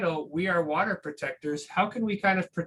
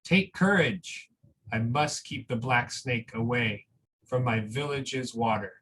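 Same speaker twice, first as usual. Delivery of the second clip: slow, gentle storybook reading with long pauses; reading a picture book aloud; 3 semitones lower than usual. Acted. Teacher reading a picture book to school families, slow and gentle.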